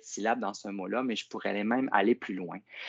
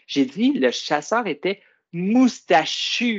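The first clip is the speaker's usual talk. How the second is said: slow, higher, moustachu with its final U drawn out; stress the rhyme; 7 semitones higher, 5 dB louder, slower than usual. Shown as a demonstration. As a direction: lean on the U at the end of moustachu. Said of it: "Regarde, écoute bien ça". (moustachu lent, le son U final appuyé)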